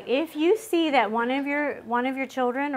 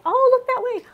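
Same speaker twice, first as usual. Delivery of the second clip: much higher and louder, sing-song; high cheery decoy voice; mock bright distraction; 14 semitones higher, 8 dB louder than usual. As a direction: steer the kid's eyes away, hammed up. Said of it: "we were like".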